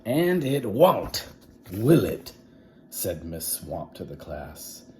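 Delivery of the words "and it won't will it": menacing. Acted. Swamp threatening class.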